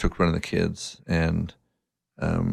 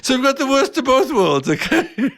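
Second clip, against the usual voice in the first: high-pitched, louder, laughing through the words; 5 dB louder than usual. Real, not acted. Dad summing up his failed lesson to the host, laughing. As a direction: squeeze it out through real laughter. Cracking up at his own story.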